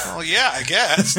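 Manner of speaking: a sheepish, giggly shrug of a reply; sheepish laugh. Retold or imitated.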